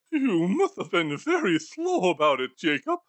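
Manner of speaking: put-on a scared old man half-crying at a ghost; whimpering Scrooge. Scrooge replies, near tears.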